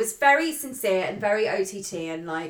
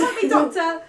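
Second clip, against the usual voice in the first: mock plea; 7 semitones higher, 5 dB louder than usual. End of the mock panic.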